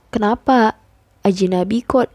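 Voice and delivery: ordinary talk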